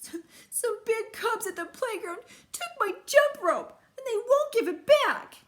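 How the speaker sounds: put-on high, loud child voice; little sister telling Mama about the bullies; upset little sister. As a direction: complain like a hurt little cub. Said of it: "Sister said".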